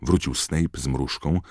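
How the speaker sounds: plain reading voice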